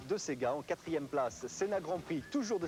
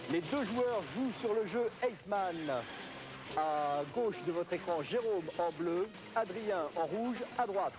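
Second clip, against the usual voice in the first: slow, clear host announcement; game show host; 2 semitones higher, slower than usual. Acted. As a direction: present the players to the crowd. Introduces the live match.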